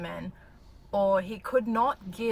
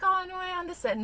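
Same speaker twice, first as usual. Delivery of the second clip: mock understanding; 9 semitones higher than usual. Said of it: "Never be like".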